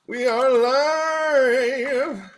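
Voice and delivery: long, high stretched announcer call. Acted. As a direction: draw it out like a ring announcer.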